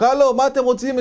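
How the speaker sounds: loud, high panicked shout. Retold or imitated. Photographer yelling at his captors, loud and high. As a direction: yell in alarm at the giants. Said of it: "והוא מתחיל לצעוק".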